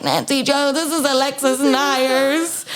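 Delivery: high, slow mock Alexis voice; slow high-pitched valley girl impression; imitating Alexis. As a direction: lift the pitch and drag it out like a spoilt girl. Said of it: "I'll put an audio here of her being like".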